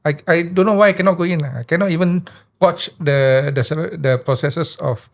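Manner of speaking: ordinary delivery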